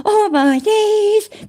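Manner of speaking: slow, high hammed-up gasp of shock; high, drawn-out mock astonishment. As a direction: stretch it out in fake outrage. Gasp at reading the accusation.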